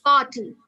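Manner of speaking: "party" as PAH-tee, no R; silent R. Shown as a demonstration. Teacher corrects a student's word, R silent. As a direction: model "party" loudly with the R dropped. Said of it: "It's not like abhi to party".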